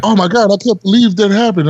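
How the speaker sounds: high-pitched mock dismay; shocked liberal friends. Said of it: "they were like".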